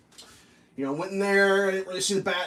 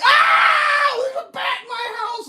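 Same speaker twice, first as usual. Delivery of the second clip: high, loud mock panicked cry; hammed-up terrified yell; freaking out; 8 dB louder, slower than usual. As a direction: shriek the alarm to the group. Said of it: "I'm like".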